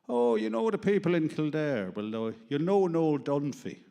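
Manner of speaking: loud, high-pitched Irishman impression; hammed-up Irish countryman asking after an acquaintance; Irish accent. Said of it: "being asked by other Irishmen usually".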